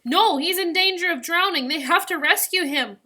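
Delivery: high, loud urgent protest; alarmed, insistent cry. A narrator's reading. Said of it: "Amara cried".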